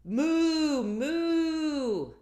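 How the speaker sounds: loud, high mooing cow; the cow answers with a loud moo. Acted. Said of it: "The cow said".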